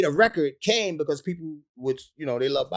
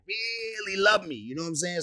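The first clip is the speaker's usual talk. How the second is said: ordinary talk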